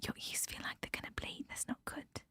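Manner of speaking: long unvoiced whisper, very soft. Real, not acted. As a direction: whisper it all like sneaking in a hallway. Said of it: "because I'm whispering".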